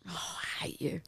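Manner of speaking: breathy whispered curse. Real, not acted. Sour apple hits her, she whispers at her friend. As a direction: hiss it under your breath.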